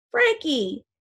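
high gasped cry of the name; boy gasping his friend's name beside the coffin. Acted. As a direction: gasp it out in shock. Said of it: "he gasps".

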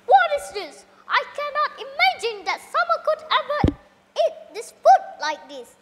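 high, loud indignant complaint; storyteller acting out a disgusted, angry diner; outraged king. A narrator's reading. King outraged at tasteless food.

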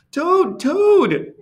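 high, urgent call; crying out. A narrator's reading. Frog calling to Toad indoors, crying out. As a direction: cry the name out high and strong. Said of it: "cried Frog".

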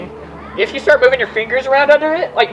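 ordinary delivery

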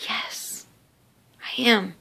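a near-whispered, hushed "Yes", then soft; quiet yes. Retold or imitated. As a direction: answer softly, almost breathless. She asks if talking about God.

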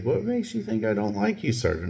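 very high, soft innocent voice; mock-innocent squeak of protest; feigned innocence. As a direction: play innocent, way up in pitch and quietly. Feigning innocence to his angry supervisor.

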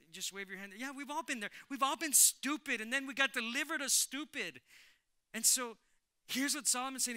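loud, high, fired-up preaching. Real, not acted. Preacher hammering Solomon's warning to the church, full force.

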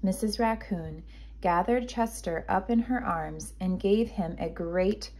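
ordinary delivery

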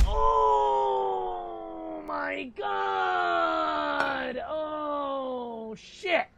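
long, stretched, drawn-out wail of panic; panicked wail. Real, not acted. Streamer wailing at a kitchen disaster, long panicked wail. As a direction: wail it out slowly in real panic.

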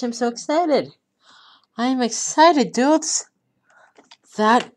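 high, loud happy yelling; excited cheer. Real, not acted. Gamer cheering his viewers as the run succeeds. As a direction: let the joy burst out. Celebrating again.

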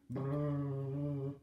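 rolled lips drum roll. Shown as a demonstration. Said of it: "That's a drum roll".

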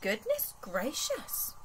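slow, marvelling goodness gracious; drawn-out storybook wonder; Teddy amazed. Acted. Teddy marvels at the strange sight, slow wonder. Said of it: "thought Teddy".

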